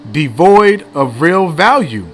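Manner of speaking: slow and loud, leaning on "Devoid" and "value"; emphatic definition. Real, not acted. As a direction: read "Devoid of real value" out loudly, word by word. Emphatic reading of dictionary definition.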